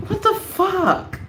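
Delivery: high, stretched-out incredulous cry; exasperated disbelief. Acted. Speaker appalled by parents' baby-talk, exasperated. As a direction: draw it out high in mock horror.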